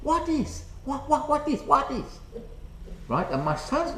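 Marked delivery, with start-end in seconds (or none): 0.0-2.0 high-pitched, staccato imitation of a Chinese accent; caricatured Chinese-accented English, pitched well up; mock Chinese accent. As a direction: imitate a puzzled Chinese passer-by. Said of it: "probably in a Chinese voice".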